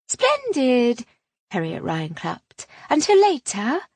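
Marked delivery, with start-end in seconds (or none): 0.1-1.1 high, pushy society chatter; gushing lady. A narrator's reading. Harriet voiced by narrator. 2.9-3.8 high, pushy society chatter; gushing lady. A narrator's reading. Harriet voiced by narrator.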